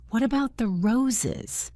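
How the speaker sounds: lightly voiced girl, anxious for the roses; a touch of worry; worried. A narrator's reading. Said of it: "asked Mary worriedly".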